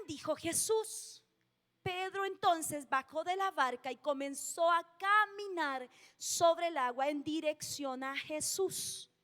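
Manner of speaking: usual speaking voice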